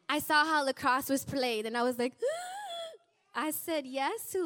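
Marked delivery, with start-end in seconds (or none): 2.2-2.9 sharp high gasp of horror; mock horrified gasp. Mother seeing lacrosse played for the first time, horrified gasp. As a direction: gasp as if you just saw something awful.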